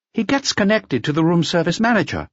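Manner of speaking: high-voiced, helpful hotel employee; hotel receptionist. A narrator's reading. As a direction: answer as the front-desk clerk, pitched right up. Receptionist answering inspector in dialogue.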